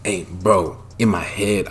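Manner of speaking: normal talking voice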